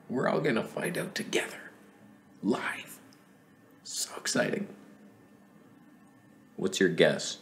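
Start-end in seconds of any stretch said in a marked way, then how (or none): 0.1-4.7 hushed, eager whisper; excited whisper. Real, not acted. Teasing viewers before the reveal, excited whisper.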